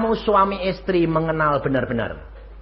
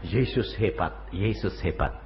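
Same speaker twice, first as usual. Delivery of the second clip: subdued awe; 11 semitones lower, 9 dB quieter than usual. Retold or imitated. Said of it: "Dan dia bilang".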